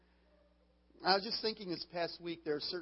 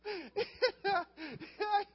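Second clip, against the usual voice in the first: high, loud burst of laughter; real cracking up at his own story; real helpless laughter; 4 dB louder than usual. Real, not acted. Punchline of his funny story.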